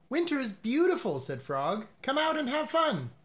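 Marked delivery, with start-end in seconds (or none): 0.1-1.2 excited. A narrator's reading. Frog coaxing Toad outside. 2.0-3.1 high, bright coaxing call; excited. A narrator's reading. Frog urging Toad out into the snow, high and eager.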